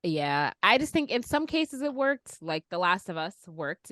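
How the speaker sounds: normal talking voice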